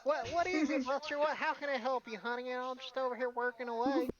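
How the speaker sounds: put-on high, sweet old granny; Meemaw voice. Philia answering Buster while working, high sweet granny voice. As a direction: coo it like a busy old grandma. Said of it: "Philia should sound like Meemaw".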